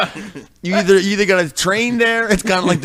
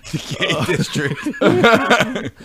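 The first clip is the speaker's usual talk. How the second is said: says it through a laugh; laughing it out slow; much slower than usual. Real, not acted. Laughing repeat of phrase.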